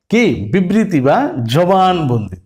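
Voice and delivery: ordinary delivery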